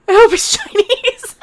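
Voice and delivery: high, slow words breaking into laughter; cracking up. Real, not acted. Streamer cracking up at their own joke about the voice, laughing. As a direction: laugh right through the words.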